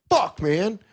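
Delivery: high, pleading frustration at a dead father; frustrated plea. Retold or imitated. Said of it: "'Cause you're like".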